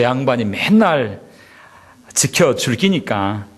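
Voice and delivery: slow, folksy Gyeongsang dialect recitation, half laughing. Pretend. Pastor reciting Psalm 23 in Gyeongsang dialect to amuse the congregation, slow and folksy. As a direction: lay on the Gyeongsang accent like a country elder. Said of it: "또 뭐라고 말하고 있냐면".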